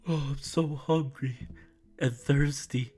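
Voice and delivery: drawn-out, pitiful begging; slow "oh, I'm so hungry" plea; pleading hunger. Acted. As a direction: beg weakly like you're starving. Acting out hungry and thirsty begging.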